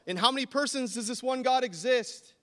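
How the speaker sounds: loud, high shouted question; yell. Pretend. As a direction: call it out across the street. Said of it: "And I yell".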